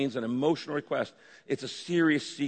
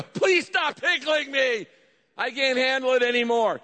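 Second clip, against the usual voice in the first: passionate plea; 9 semitones higher, 4 dB louder than usual. Shown as a demonstration. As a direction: beg at full pitch as if tickled past bearing. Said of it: "And you are passionate".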